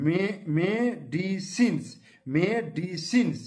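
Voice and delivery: মেডিসিনস stretched slowly, syllable by syllable; slow demo pronunciation. Shown as a demonstration. Teacher to class, demonstrating the word slowly. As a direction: draw মেডিসিনস out slowly and clearly. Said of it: "মেডিসিনস উচ্চারণ".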